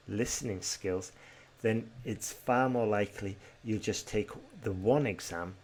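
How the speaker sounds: usual speaking voice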